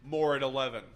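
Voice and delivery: news anchor. Pretend. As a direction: deliver it like the late news. Mock news sign-off.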